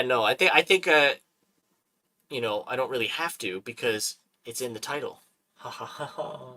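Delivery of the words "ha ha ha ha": soft, spelled-out mock laugh; a deadpan ha ha ha at his own joke; quiet mock chuckle; fake laugh. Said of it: "See what I did there?".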